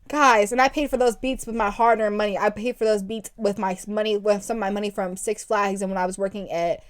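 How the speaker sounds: ordinary delivery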